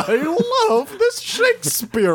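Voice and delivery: very high, slow theatrical voice; hammed-up drama spirit. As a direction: stretch it out in a mock thespian wail. Mock voice of a drama club spirit.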